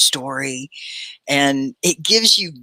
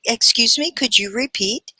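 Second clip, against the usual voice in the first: polite request; 7 semitones higher than usual. Shown as a demonstration. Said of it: "Just say".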